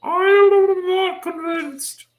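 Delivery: very high, slow cartoon voice; squeaky, haughty and doubtful; micromanager voice. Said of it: "you did the funny micromanager voice".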